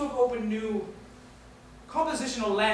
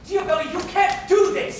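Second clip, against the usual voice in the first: loud, raised-pitch plea; urgent protest; 5 semitones higher, 5 dB louder than usual. Acted. Secretary begs publisher not to publish, urgently. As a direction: protest hard, desperate to stop him.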